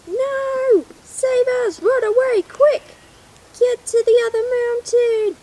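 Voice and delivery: high, loud make-believe screams for help; hammed-up frantic cries; mock panic. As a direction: shout to the others to run for their lives. Little dinosaurs flee in panic.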